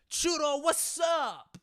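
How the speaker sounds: high-pitched shouted hello; whooping, high greeting; excited greeting. Real, not acted. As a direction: holler it way up high to hail a friend. Hails a viewer arriving in chat.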